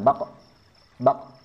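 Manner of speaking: "bako, bak" with only a short bounce of q; pantulan murni. Shown as a demonstration. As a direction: release the final q without any added vowel in "bak". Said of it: "betul-betul pantulan". ("bako, bak" dipantulkan tanpa tambahan vokal)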